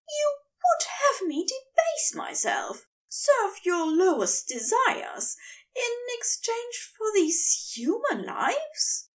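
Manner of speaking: appalled, barely believing the demand; outraged angel. A narrator's reading. Aziraphale voiced by narrator.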